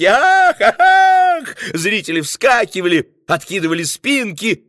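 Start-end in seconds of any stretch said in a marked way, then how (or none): 0.0-1.6 very high, loud gasps; startled cries. A narrator's reading. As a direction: cry out in surprise. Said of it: "вскрики".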